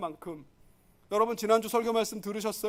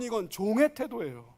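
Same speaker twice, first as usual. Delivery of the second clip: ordinary talk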